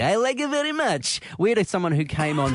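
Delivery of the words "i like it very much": high mock Borat voice; Kazakh accent. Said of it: "flawless accent from Kazakhstan".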